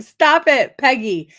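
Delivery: loud, high playful scolding; playfully scolding a friend; mock scolding. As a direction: snap it out as a joking rebuke. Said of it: "I just wanna be like".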